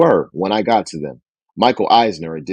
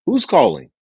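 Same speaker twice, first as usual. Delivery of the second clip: brisk assistant; 9 semitones higher than usual. Shown as a demonstration.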